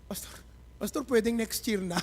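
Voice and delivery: whispered, nervous Pastor, Pastor; nervous plea. Retold or imitated. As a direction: shrink back like a scared young man.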